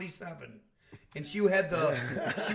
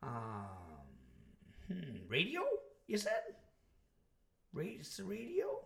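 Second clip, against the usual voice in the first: put-on old lady; 5 dB quieter than usual. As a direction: mimic a confused old operator, haltingly. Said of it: "some old lady going".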